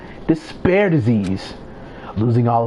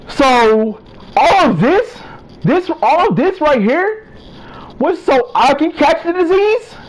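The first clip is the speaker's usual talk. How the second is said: incredulous outburst; 12 semitones higher, 4 dB louder than usual. Acted. Shocked outburst at the revelation.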